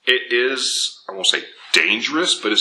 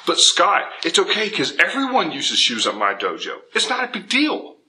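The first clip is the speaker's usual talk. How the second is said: higher-pitched mock objection; imitated student protest; 8 semitones higher than usual. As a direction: play a viewer brushing it off, pitched up. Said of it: "Now I know what you're thinking".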